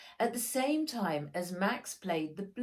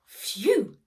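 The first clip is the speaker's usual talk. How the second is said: whispered relieved phew; 8 semitones higher, 11 dB louder than usual. A narrator's reading. Max lands safely on boat.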